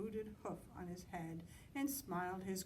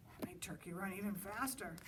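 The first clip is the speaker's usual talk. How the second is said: ordinary delivery, nothing marked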